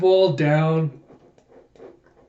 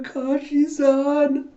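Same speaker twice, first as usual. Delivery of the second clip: put-on high, drawn-out childlike call; baby-voiced calling out; young Hinata calling; 10 semitones higher, slower than usual. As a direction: call out slowly in a high little-kid voice.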